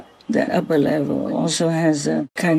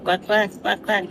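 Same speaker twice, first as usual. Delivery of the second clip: imitating duck voice; 4 semitones higher, 3 dB quieter than usual. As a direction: quote the fox's quacking lightly, no higher. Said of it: "sound like a duck person".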